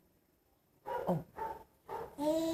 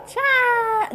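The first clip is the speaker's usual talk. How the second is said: high, drawn-out bye-bye; modelling goodbye; 7 semitones higher than usual. Shown as a demonstration. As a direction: stretch the goodbye out in a high pitch. Models waving bye for baby. (tchau agudo e prolongado)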